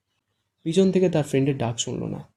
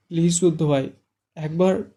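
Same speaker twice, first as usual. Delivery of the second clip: broken voice; 4 semitones higher, 4 dB louder than usual. A narrator's reading. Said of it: "ভাঙা গলায় ডাকে".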